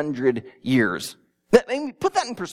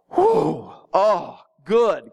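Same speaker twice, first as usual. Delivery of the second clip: relieved whew; 4 dB louder, much slower than usual. Retold or imitated. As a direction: play the relief of a worried listener. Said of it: "some of them would have gone".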